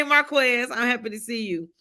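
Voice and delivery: usual speaking voice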